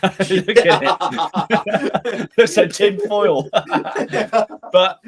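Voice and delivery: cracking up at the shirt; laughing. Real, not acted.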